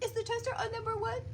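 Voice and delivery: put-on squeaky high kid voice; high fussy child asking; high-pitched son impression. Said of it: "he'll be like".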